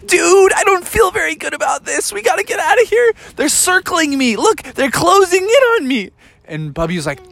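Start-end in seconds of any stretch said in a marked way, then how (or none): 0.1-6.1 much higher, rushed, scared frog voice; panicked little frog. Acted. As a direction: frog begging to flee circling sharks. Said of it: "Froggy was like".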